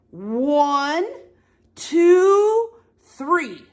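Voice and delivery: drawn-out, loud count to three; rallying count. Acted. Reader leads the animals' push, rallying count. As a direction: build to a big high TWO.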